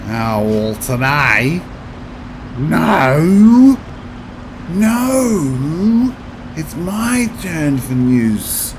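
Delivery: hammed-up Australian farmer accent, loud protesting kid; farmer accent rendition. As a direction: wail it high and drawn-out in a broad farmer accent. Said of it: "Your farmer accent".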